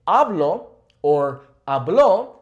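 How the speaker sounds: "hablo" stressed on the first syllable, "habló" stressed on the final O; stress contrast. Shown as a demonstration. Teacher contrasting the stress in "hablo" and "habló". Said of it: "properly pronouncing that O".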